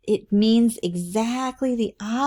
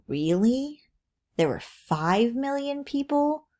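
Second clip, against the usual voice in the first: higher, disbelieving question; stretched-out, rising doubt; incredulous questioning; 6 semitones higher, slower than usual. Shown as a demonstration. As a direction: ask it like you can't believe it. Models a skeptical question to the example.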